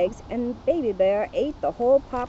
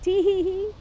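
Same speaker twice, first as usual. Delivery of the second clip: high sly tee-hee-hee giggle; high mischievous witch titter; 9 semitones higher than usual. Acted. Witch giggling outside the house.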